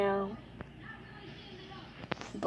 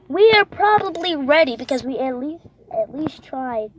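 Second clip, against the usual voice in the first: excited; 5 semitones higher, 9 dB louder than usual. Real, not acted. Excited summing up.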